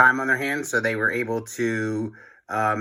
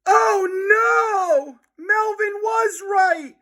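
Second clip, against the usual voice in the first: much higher, louder alarmed cry; panicked shout; 3 dB louder, much slower than usual. A narrator's reading. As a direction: scream it in horror. Boys panic at toilet monsters.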